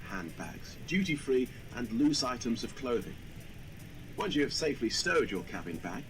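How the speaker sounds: ordinary delivery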